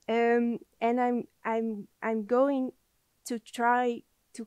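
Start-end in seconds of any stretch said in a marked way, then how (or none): none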